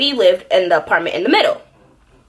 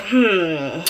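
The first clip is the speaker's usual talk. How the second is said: plotting inner voice; 4 semitones lower than usual. Retold or imitated. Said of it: "in my head, I'm plotting".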